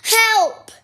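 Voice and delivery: high, loud shout of help; loud cry for help. A narrator's reading. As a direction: call out loudly for help. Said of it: "then very loudly".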